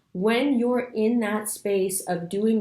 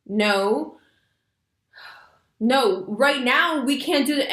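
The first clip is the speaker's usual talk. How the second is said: mock-firm refusal, pitched up and drawn out; exasperated parent; 5 semitones higher, 4 dB louder, slower than usual. Parent answering a toddler's off-topic requests, mock exasperated. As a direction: play a parent insisting against a toddler's requests. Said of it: "you're like".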